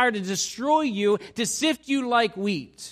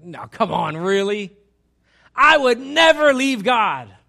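loud, slow, emphatic vow; insistent Peter; 3 semitones higher, 12 dB louder, slower than usual. Acted. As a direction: protest with total conviction. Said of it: "That's Peter".

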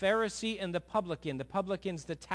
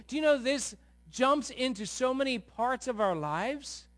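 usual speaking voice

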